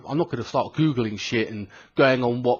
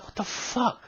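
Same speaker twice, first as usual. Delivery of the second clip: high, soft gasp of amazement; retold astonishment, pitched right up and hushed; stunned disbelief; 10 semitones higher, 6 dB quieter, slower than usual. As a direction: marvel under your breath, high-pitched. Retold shock at giant firemen.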